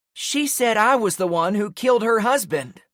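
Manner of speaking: high loud shriek. A narrator's reading. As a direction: shriek it in fury. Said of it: "the woman shrieked".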